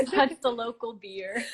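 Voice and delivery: slow words broken by laughter; laughing. Real, not acted. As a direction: explain it through the giggles. Explains the joke while laughing.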